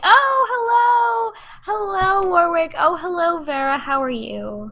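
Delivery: high, gushing friendly mom voice; overly sweet greeting to the family. Acted.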